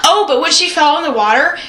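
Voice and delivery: normal talking voice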